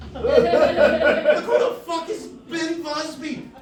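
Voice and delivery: high-pitched indignant outburst; outraged. Retold or imitated. Winner seeing the wrong name on screen, outraged. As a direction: protest in rising, comic outrage.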